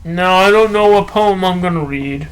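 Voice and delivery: normal talking voice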